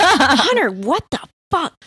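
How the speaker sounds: mock-furious, yelling at the friend who confessed; angry outburst. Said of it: "I got so mad at him".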